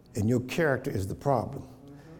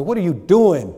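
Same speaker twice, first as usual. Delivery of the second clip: sharp high shout at your own reflection; scolding yourself; 6 semitones higher, 10 dB louder than usual. Pretend. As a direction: yell it at yourself in the mirror, higher and louder. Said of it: "look in the mirror and say".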